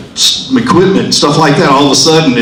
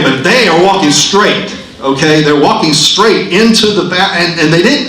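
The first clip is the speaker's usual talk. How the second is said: normal talking voice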